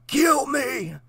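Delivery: high, soft desperate plea; pleading. A narrator's reading. Trapped Jesse begging the girl softly to kill him. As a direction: beg quietly for release. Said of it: "he pleaded".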